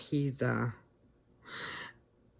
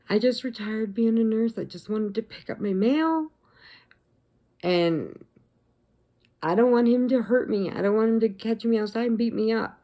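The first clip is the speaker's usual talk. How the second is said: higher, lightly acted distressed pleading; imitated upset voice; 6 semitones higher, 4 dB louder than usual. Retired nurse afraid of angry man, mildly acted distress. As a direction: retell her fright in a raised voice. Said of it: "starting to shake in her voice".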